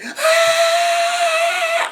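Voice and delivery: shrill, silly mock scream; ridiculous scream. Mimicking the actor's silly scream, high and loud. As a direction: scream like a cartoon panic. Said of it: "starts screaming in the most ridiculous way".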